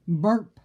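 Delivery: burp. Acted. Sound effect burp.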